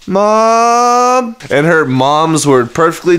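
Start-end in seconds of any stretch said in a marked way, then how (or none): 0.1-1.4 high, drawn-out call for mom; little sister calling out. Retold or imitated. Little sister calling mom at night, high drawn-out call. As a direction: call out as a child wanting mom. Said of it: "it'd start like that".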